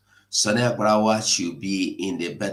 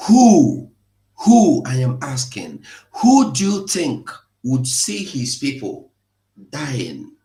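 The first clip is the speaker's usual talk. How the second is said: angry shout; 3 semitones higher, 7 dB louder than usual. Real, not acted. Furious at rivals who mocked his video, loud outraged shout. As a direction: shout the question at them.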